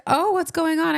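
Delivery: high, alarmed worried voice; lightly voiced worried mom; high concerned mom voice. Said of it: "She was like".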